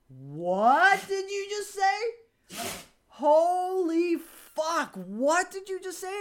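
high, loud, slow stretched yell; outraged shout. Retold or imitated. Yelling at a best friend over his remark, outraged. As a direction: bellow it out slowly in outrage.

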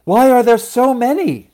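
loud, high, urgent demand; alarmed mother demanding answers. A narrator's reading. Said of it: "she demanded".

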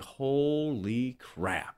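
awed hush. Retold or imitated. Awed jogging into stadium.

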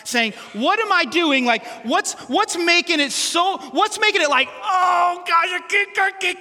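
ordinary talk

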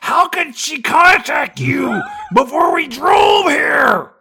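high, loud, slow dumb-cop yell; mock detective shout. Detective confronting Sharon Stone, high slow shout.

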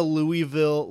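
"Louisville" said LOO-ee-vil; "Louisville" in three parts, Louie plus ville. Shown as a demonstration. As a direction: say "Louisville" with a clear ee in the middle. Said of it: "everyone outside of Kentucky calls it Louisville".

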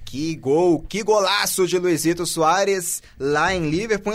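ordinary talk

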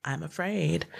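hushed mock fear; softly imitated worry; imitating fearful woman. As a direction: retell a frightened woman's words in a very soft voice. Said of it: "she's like, "Oh my God".

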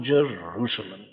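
Jerusalem, let the second call fade in grief; lament. Acted. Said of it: "Can you hear this note of lament?".

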